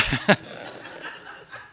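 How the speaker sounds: laughing at his own story; amused laugh. Real, not acted. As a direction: break into a real laugh after the punchline.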